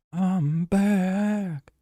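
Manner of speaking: "back" sung out high and drawn long; high theatrical comeback call; high announcer-style I'm back. Shown as a demonstration.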